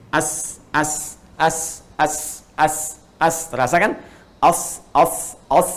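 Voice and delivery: short a then a hissed s, over and over; teacher drilling as; as with sukun. Shown as a demonstration. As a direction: repeat as, as, cutting the vowel short. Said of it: "Pakaikan misalnya hamzah depannya".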